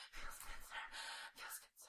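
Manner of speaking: fading to a whisper. Real, not acted. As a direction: let it trail into a whisper. Fading reply.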